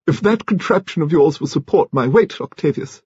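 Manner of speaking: high-pitched voice for a commanding lady; Lady Lacklander. A narrator's reading. Lady taking a rustic seat before Octavius, high and imperious.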